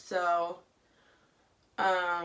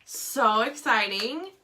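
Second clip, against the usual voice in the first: excited; 4 semitones higher, 8 dB louder, slower than usual. Real, not acted. Seattle trip excitement.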